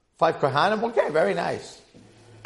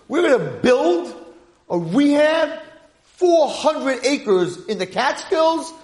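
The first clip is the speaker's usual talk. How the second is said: high, forceful proclamation; rally the troops, voice pushed way up; loud, fired-up leader's speech; rallying cry; 9 semitones higher, 4 dB louder than usual. Acted. Acting out an imagined rallying leader.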